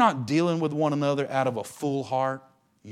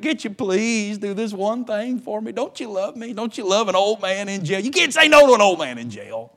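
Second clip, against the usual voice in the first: put-on pleading old man; 7 semitones higher, 5 dB louder than usual. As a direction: lay it on thick like a pitiful old prisoner. Paul voiced as pleading old man.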